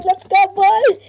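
loud, high rally to the squad; hyped rally cry. Real, not acted. Match starting, rallying team.

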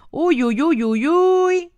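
high, slow uy uy uy; mock alarm. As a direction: tease her with a drawn-out alarmed uy. Teasing about a phone number. (uy uy uy alargado y agudo, de broma)

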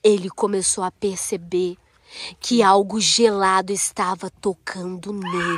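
spooky storytelling. A narrator's reading. Mother telling a horror story.

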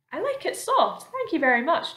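put-on high-pitched boy's reply; polite James. A narrator's reading. James politely answers Miss Spider.

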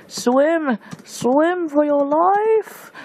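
high, slow, drawn-out yell; stretched-out desperate cry to swim; frantic yell. Real, not acted. Yelling at his character to swim.

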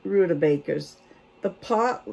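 ordinary talk

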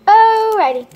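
loud, high call-out. Real, not acted. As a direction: belt it out over the noise. Loud call over noise.

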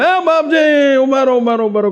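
mock yell of a devotee running after the car; retold calling out. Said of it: "બૂમો પાડતા આવે". (બૂમ પાડીને બોલાવવું, થોડું ઊંચું)

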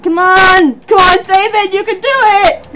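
excited, straining high shout; voice cracking. Real, not acted. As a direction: urge them on until your voice cracks. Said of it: "My voice is cracking!".

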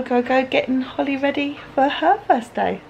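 ordinary talk, nothing marked

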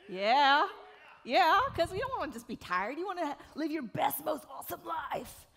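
shouting excitedly to pump up the audience; hype shout. Real, not acted. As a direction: fire up the crowd. Pumping up the audience.

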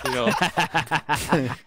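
cracking up. Real, not acted. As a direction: lose it to laughter mid-boast. Jokes about awards, cracks up.

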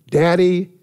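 calling out loud. Acted. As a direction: announce it with fervour. Preacher calling out the word.

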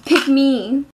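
coy, pleading little voice; coaxing pick me. Acted. A bitch begging the little boy to pick her, coy and coaxing. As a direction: tempt the boy to choose her.

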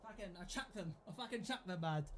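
very soft, low mumbling; muttered grumble. Real, not acted. Player hunting for thrown headphones, muttered grumble. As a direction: mutter it to yourself, well away from full voice.

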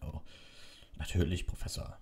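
worn-out, fading reply; exhausted. A narrator's reading. Hagrid answering the professor, drained. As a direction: let it trail off wearily. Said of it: "offenbar erschöpft".

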